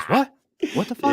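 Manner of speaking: high-pitched, incredulous retold reaction; mock astonishment; imitated disbelief. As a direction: mimic your startled friend, voice jumping up. Retold friend's startled reaction.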